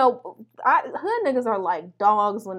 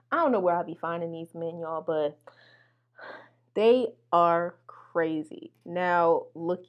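ordinary talk

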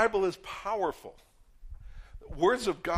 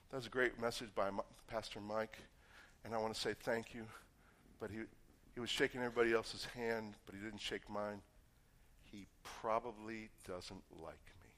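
a hint of hurt self-talk, much softer and lower; insecure inner thought; 7 semitones lower, 13 dB quieter than usual. Churchgoer brooding over a missed handshake, soft and low. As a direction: mumble the worry to yourself. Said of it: "one of those thoughts like".

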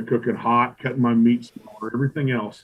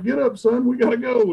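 high, raised voice rushing him; urgent hurry-up; 12 semitones higher, 3 dB louder than usual. Retold or imitated. Kicking his teammate awake, late.

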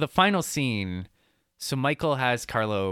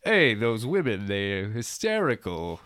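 hammed-up Boston accent, slow at the end; a lazy put-on Boston drawl. Michael dismissing the sister in a put-on Boston accent. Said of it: "Boston accent".

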